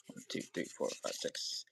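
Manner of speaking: breathy count under the breath; counting quietly to self; whispered counting. Real, not acted. Streamer counting cards in a whisper. Counting silently while shuffling.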